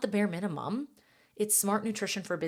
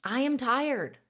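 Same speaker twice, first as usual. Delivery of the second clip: lightly voiced body, higher, calling for attention; a hint of a pleading inner voice, higher; 6 semitones higher, 3 dB louder, slower than usual. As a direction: play the tired body nagging at mom. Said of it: "your body is whispering or yelling".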